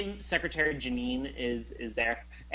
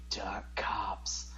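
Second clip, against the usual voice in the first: whisper; 4 semitones lower, 8 dB quieter than usual. Real, not acted. Whispered aside.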